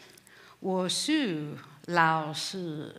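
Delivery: very slow, drawn-out 我是老师 with botched tones; mistoned learner's Mandarin. Retold or imitated. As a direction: say 我是老师 laboriously, stretching each syllable, tones off like a beginner. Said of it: "What I really said is".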